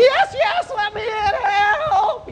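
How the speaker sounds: very high shriek stretching into a wail; panicked scream. Acted. Old man running for the cabin door, high desperate scream. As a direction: beg for shelter in a high scream. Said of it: "The old man's going".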